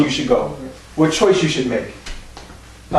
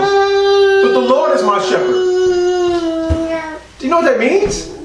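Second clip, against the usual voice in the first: higher, slow, fervent proclamation; preaching climax; 10 semitones higher than usual. Acted. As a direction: lift it high and stretch it out. Preacher rising to a peak.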